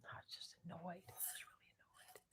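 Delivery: barely audible whisper; whispered aside. Real, not acted. Muttering an aside about being annoyed, whispered. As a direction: whisper it under your breath. Private aside under her breath.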